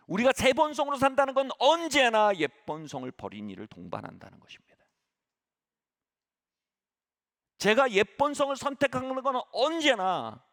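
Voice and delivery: high, loud, driving sermon cadence; preaching at full force. Acted. Pastor exhorting congregation on old nature, full-force preaching. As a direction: build each claim to a ringing peak.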